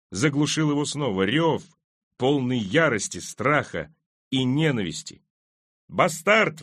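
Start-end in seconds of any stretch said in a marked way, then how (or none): 5.9-6.6 high jeering cry; crowd yell. A narrator's reading. Angry crowd screams at the king, high yell. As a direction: yell it out, pitch right up. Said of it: "вопили голоса".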